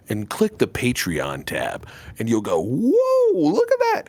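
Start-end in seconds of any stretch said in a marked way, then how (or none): 2.6-4.0 high, awestruck "whoa"; mock amazement. Podcaster voicing a listener discovering the Patreon, mock amazement. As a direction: gape in hammed-up wonder, pitched way up. Voices awed listener's reaction.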